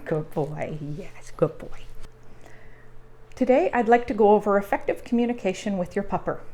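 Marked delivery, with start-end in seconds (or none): 0.1-1.8 whispered praise. Real, not acted. Owner softly praises her sleepy dog, whispering. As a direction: hush it to a sleepy pup.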